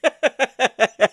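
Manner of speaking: high, airy laugh; amused real laugh; burst of laughter. Real, not acted. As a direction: laugh at the panicked voicemail. Laughs after the voicemail.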